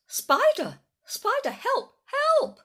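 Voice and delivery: loud, very high cries for help; a huge dinosaur shrieking at a tiny spider; panicked cries. Acted. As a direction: scream for help in total panic. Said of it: "cried the Gigantosaurus".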